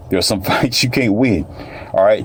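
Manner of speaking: ordinary talk, nothing marked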